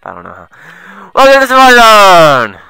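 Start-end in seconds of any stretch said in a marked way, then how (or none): 1.2-2.6 loud, high welcome cry; park announcer. Acted. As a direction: belt out a showy park greeting. Voices the game's park greeter.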